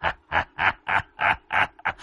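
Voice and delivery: eerie distant cackle; demonic double voice. A narrator's reading. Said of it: "nói bằng cái giọng ma quỷ".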